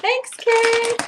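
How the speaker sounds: high, loud playful thank-you; big cheery high call. Real, not acted. Playful thanks called out to someone.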